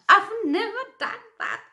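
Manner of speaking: high, loud, stressed vow; emphatic vow. Real, not acted. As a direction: proclaim "I've never done that" high and loud. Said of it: "repeated vow".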